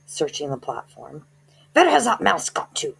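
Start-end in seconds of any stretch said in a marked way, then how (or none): 1.7-3.0 higher, louder sharp witch voice; witch snapping. Acted. Grand High Witch snapping as she searches the platform. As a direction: snap it like an angry witch.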